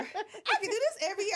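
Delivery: high, laughing eager voice; excited patient. Retold or imitated. A patient eager for surgery again, high and laughing. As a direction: gush it like a thrilled patient. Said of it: "one girl".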